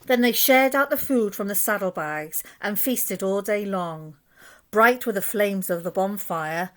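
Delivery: usual speaking voice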